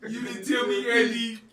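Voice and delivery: fake-offended shout through laughter; laughing mock outrage. Real, not acted. Friend scolds Andy for not telling him, loud laughing.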